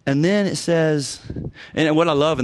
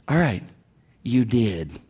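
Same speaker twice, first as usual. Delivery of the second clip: normal talking voice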